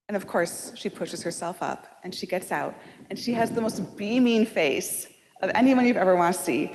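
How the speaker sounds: normal talking voice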